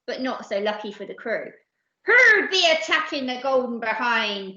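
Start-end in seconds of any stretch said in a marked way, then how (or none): 2.0-4.5 loud pirate captain's bellow. Acted. Captain under attack to his crew, bellowing. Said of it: "bellowed the captain".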